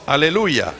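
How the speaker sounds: ordinary delivery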